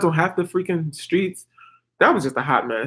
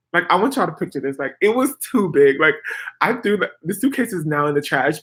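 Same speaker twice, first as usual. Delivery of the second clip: ordinary talk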